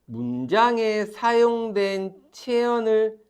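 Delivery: slow dictation. Shown as a demonstration. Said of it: "dictating a definition slowly".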